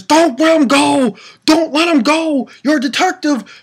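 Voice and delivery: high-pitched desperate yell; pleading shout. Retold or imitated. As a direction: plead loudly from the ground, acted out. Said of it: "Genozo on the ground says".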